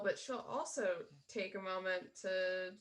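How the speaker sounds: ordinary talk, nothing marked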